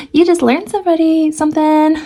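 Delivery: high amused correction; playful laughing. Real, not acted. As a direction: laugh through the words. Joking self-correction.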